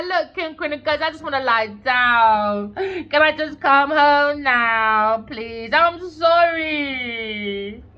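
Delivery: high, loud, slow hammed-up sob; mock crying. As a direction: wail the apology like a sulking lover begging to come home. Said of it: "Leo's crying".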